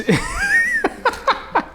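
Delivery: very high squeal of laughter; shrieking giggle. Real, not acted. Laughs before mock complaint.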